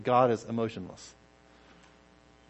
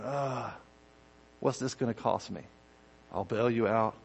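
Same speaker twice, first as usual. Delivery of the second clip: begrudging grumble; 3 semitones lower, 8 dB quieter than usual. Pretend. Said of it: "He's not doing it shaking his head".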